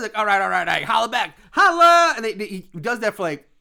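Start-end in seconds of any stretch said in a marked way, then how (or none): 0.2-2.1 loud, raised pitch building to a holler; hyped crowd call. Retold or imitated. Comedian demands crowd answer, building shout. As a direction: work the crowd, then yell the last word. Acting comedian repeating his catchphrase.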